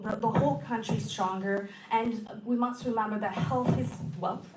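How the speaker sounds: normal talking voice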